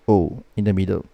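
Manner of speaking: usual speaking voice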